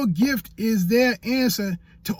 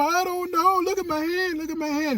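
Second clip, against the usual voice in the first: whiny, much higher mock whimper; hammed-up sob over the hand; mock crying; 11 semitones higher than usual. Imitating himself whining to onlookers about his hand, mock whimpering, much higher. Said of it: "I started crying".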